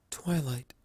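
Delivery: breathy unvoiced whisper of a name. A narrator's reading. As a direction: barely whisper it, then stop. Celestia breaks silence, stops.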